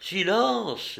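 high, commanding hush; authoritative command. A narrator's reading. Pansa's wife hushing the girl, with authority. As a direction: silence her with authority. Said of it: "dit la femme de Pansa avec autorité".

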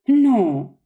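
a firm no that is only put on; feigned conviction. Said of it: "avec une conviction jouée".

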